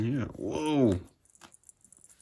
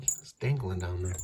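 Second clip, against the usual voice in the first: normal talking voice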